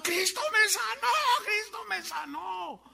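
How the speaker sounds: high, crying shout of a healed man, acted out; jubilant shouting. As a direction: cry it out high, as if running and jumping. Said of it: "Gritando y brincando".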